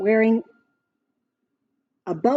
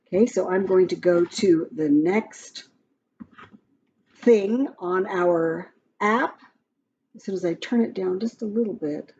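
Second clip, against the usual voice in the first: usual speaking voice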